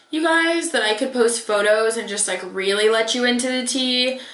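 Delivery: ordinary talk, nothing marked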